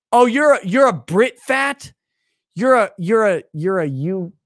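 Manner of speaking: loud, high mock outrage; mock fat shaming. Streamer fat-shames a viewer on request, mock outrage. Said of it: "could I get a drunk fat shaming".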